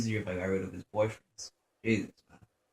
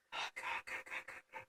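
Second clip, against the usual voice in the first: whispered; 21 dB quieter than usual. Real, not acted. Breathless relief after near death.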